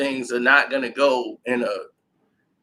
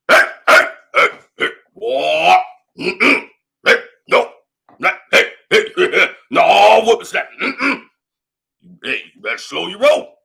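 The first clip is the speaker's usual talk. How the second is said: put-on a louder, fussy old coach voice; old man talk; 2 semitones higher, 5 dB louder than usual.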